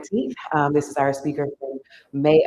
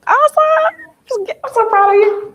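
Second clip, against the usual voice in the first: high, loud, delighted cheer; excited praise; 8 dB louder than usual. Real, not acted. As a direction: gush with pride. Host praising a presenter.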